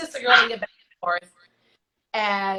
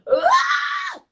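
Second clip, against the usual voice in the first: excited scream; 4 dB louder than usual. Retold or imitated. Excited scream at getting the job.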